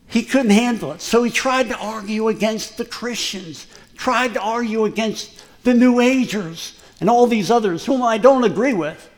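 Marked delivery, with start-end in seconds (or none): none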